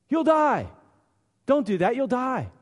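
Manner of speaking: high, loud mock warning; voice of the flesh. Preacher voicing the flesh, high mocking warning.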